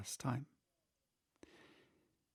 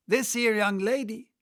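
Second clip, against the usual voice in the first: higher, louder, coarse griffin; brash griffin; 8 semitones higher, 5 dB louder than usual. Acted. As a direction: announce her bluntly like a rough beast. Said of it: "said the griffin".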